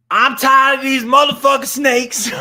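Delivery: high, full-force furious shout; Samuel L. Jackson. Acted. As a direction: explode into a movie-hero tirade. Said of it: "Do a Samuel L. Jackson".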